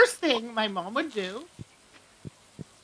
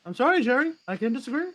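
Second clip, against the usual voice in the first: fake Jerry voice. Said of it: "the fake Jerry voice to say that".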